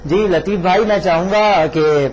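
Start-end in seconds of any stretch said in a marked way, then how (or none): none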